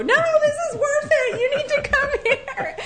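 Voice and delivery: insistent, high-pitched mock yelling; imitating a yell. Woman insisting her husband come see, imitated yelling. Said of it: "she yells back to her husband".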